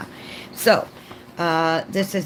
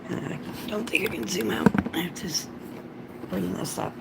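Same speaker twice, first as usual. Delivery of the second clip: whispering; 7 dB quieter, faster than usual. Real, not acted. Whispered aside to herself.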